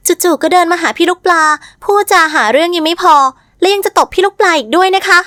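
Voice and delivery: mincing, affected. A narrator's reading. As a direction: tell on her with simpering airs. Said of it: "เธอจีบปากจีบคอพูด".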